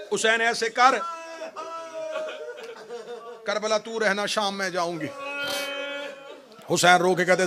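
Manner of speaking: usual speaking voice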